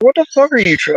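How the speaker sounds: shrill-high disbelieving yelp, voice jumping way up; sudden high exasperated cry; high-pitched outburst. Retold or imitated. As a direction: squeal it up high in outraged disbelief. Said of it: "I was like".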